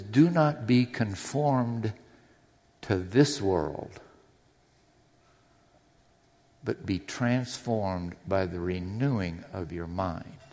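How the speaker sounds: solemn, unhurried recital of scripture; measured reading from the pulpit; slow scripture reading. Shown as a demonstration. Pastor reads Romans verse aloud.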